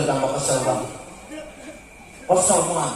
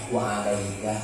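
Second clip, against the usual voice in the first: calm reply; 6 semitones lower, 7 dB quieter than usual. Retold or imitated.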